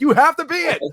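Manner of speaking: high put-on priest voice; hammed-up insistent priest; mock priest. Comic priest insisting on the obvious to churchgoers. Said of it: "It's like".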